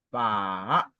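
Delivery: "pa" dipping low, then rising; long low dip on "pa"; third tone. Shown as a demonstration. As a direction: sink on "pa", then swing it upward. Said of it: "le troisième ton".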